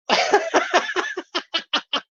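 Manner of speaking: high, helpless cackle; real high-pitched laugh. Real, not acted. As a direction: crack up at your own impression. Laughs at own Bristol impression.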